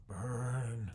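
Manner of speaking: loud burn, the madman cheering the fire; crazed cry. Acted. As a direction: let it burst out, unhinged. Crazed character staring at fire.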